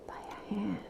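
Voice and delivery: soft eerie whisper; whispered reveal. A narrator's reading. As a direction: drop to a breath. Ghost's hand revealed.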